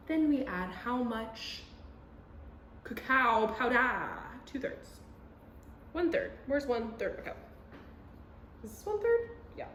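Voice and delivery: normal talking voice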